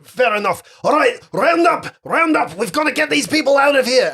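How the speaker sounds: high, loud rallying call; barking orders. Acted. Commander rallies his people to round up, shouted orders. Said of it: "he starts giving orders".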